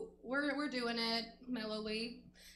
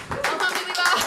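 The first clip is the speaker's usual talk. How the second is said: loud, high parting cry; shouted sign-off; 6 semitones higher, 12 dB louder than usual. Real, not acted. Comic closing the set to applause, shouting. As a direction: holler it out to the whole room.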